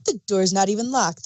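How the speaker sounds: usual speaking voice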